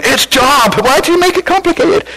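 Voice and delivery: indignant Job correcting everyone; exasperated Job. Retold or imitated. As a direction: play Job fed up in heaven. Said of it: "maybe he's in heaven going".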